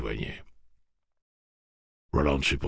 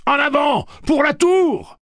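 battle cry; 11 dB louder than usual. Acted. Charging into battle.

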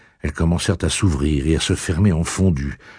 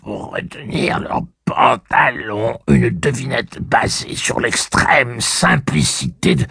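slow stating voice; 7 semitones higher, slower than usual. A narrator's reading. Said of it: "répéter la question sous forme d'affirmation".

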